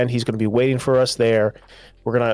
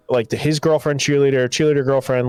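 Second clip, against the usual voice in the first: normal talking voice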